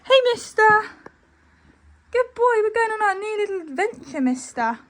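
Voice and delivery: high, sweet pet-talk voice; talking to a horse. Real, not acted. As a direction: coo at him like a pet.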